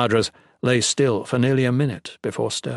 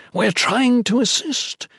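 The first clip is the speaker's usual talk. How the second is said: high, slow, struggling for breath; labored breathing; 13 semitones higher, 4 dB louder, slower than usual. Acted. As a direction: force the words out with little breath. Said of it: "The words struggled from his mouth".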